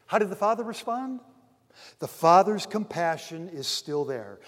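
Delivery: normal talking voice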